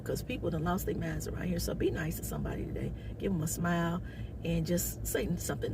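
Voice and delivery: soft, confiding whisper; whispered aside. Real, not acted. Closing advice to viewers, a soft whisper. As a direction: lean in and whisper the warning.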